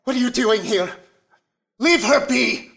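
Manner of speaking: loud protective yell; angry shout. Acted. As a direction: shout at the captor to leave her. Cleric confronts lost loved one.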